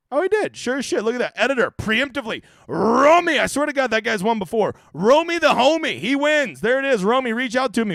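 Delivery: high and loud, thrilled disbelief; excited. Real, not acted. Fantasy host cheering a rival's winning lineup, excited.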